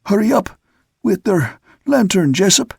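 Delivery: high call broken by breaths; breathless shout, gasping between words; catching breath. A narrator's reading. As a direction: urge him up, winded from climbing. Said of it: "catching his breath between the words".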